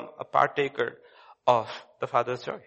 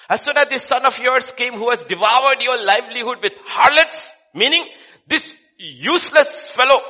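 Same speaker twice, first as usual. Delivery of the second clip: higher, louder contemptuous rant; scornful elder son; 10 semitones higher, 11 dB louder than usual. Acted. Elder son sneers to father about the useless brother, scornful. Preacher mocks elder son's words.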